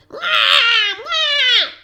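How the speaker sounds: put-on very high loud baby cry; baby wail. Baby cries.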